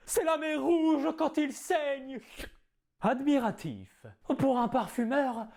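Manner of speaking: theatrical Cyrano declaiming; grand stage verse delivery; hammy stage actor. Acted. Performs Cyrano's nose tirade.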